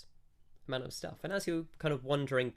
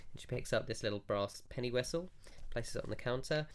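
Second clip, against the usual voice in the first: ordinary delivery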